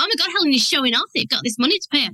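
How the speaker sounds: fast, rushed inner-critic voice. Retold or imitated. Helen quoting her anxious inner voice, fast. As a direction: rattle it off like a nagging thought. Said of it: "that inner voice in my head was like".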